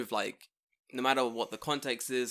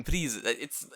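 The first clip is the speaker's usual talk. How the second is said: ordinary delivery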